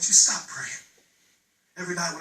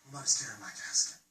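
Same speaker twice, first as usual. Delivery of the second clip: much softer, low and hushed; hushed repeat; 5 semitones lower, 9 dB quieter than usual. Acted. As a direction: drop it almost to a whisper. Repeated line dropped to near whisper.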